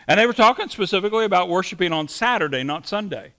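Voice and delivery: ordinary talk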